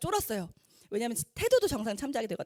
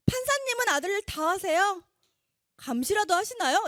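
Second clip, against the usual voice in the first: higher, louder melodramatic protest; morning drama tone; 8 semitones higher, 5 dB louder than usual. Retold or imitated. Mother defying the judge in a melodramatic soap tone. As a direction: play the defiant soap-opera wife. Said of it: "아침 드라마 톤으로".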